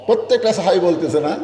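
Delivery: normal talking voice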